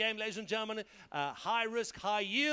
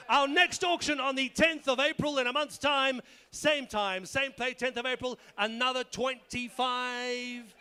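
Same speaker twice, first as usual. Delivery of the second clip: announcer; 5 semitones higher, 7 dB louder than usual. Real, not acted. Closing announcement to the room.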